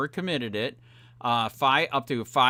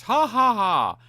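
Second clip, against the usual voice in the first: scornful laugh; 5 semitones higher, 4 dB louder than usual. Real, not acted. Mocking the commissioners' laughter.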